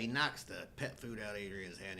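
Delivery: ordinary delivery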